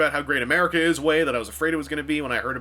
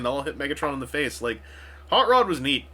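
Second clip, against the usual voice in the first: ordinary talk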